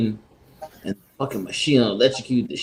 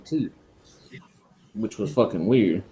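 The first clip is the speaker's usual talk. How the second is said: normal talking voice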